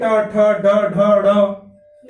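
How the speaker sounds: teacher modelling retroflex letters; retroflex demo. Shown as a demonstration. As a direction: curl the tongue and bark out ठ, ड, ढ. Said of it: "अब आप बोलिए ट".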